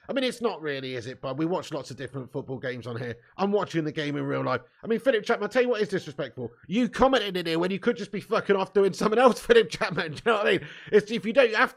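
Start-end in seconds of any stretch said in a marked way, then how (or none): none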